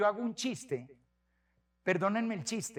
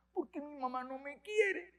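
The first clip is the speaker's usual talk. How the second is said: put-on sad little boy; 6 semitones higher, 8 dB quieter than usual. Fifth-grade boy telling the priest he's sad, soft and high. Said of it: "un niño de quinto".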